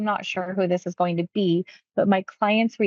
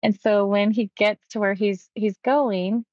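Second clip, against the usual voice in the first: ordinary delivery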